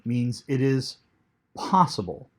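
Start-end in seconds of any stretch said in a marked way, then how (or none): none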